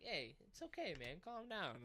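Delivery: lightly voiced cat saying calm down; voicing the cat. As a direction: play the cat soothing its owner. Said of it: "he was just like".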